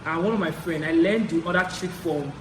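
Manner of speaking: normal talking voice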